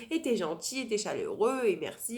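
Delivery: loud gushing list, starting high; mock effusive thanks. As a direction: imitate piling on compliments. Mimics effusive thanks she never gave.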